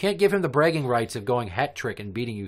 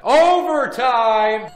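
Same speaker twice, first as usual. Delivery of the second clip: announcer shout; 14 semitones higher, 11 dB louder, much slower than usual. Acted. Host announces overtime.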